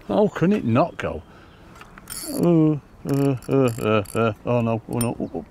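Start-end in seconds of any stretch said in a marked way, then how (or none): 0.1-1.2 higher and slower, stressing HOW in disbelief; exasperated disbelief. Real, not acted. Angler baffled that the fish won't take, exasperated. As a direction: drag out "How" as if the fish defies logic.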